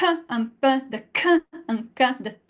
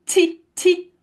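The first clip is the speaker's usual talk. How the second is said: ordinary talk, nothing marked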